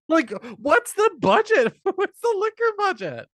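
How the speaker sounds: high, loud, amused disbelief; incredulous. Real, not acted. Host marvelling at liquor poured everywhere, incredulous. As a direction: squeal the question through real amusement.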